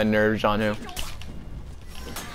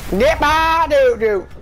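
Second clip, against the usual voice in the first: loud, very high, drawn-out cry; excited yell; 5 dB louder, slower than usual. Real, not acted. Gamer blasting a bot off a cliff, excited yell. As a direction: yell it high as the move lands.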